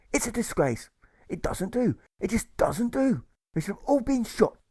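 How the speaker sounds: a gossip in full moral indignation; indignant money changer. A narrator's reading. Money changer outraged at the released objectors, very high. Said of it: "the money changer replies".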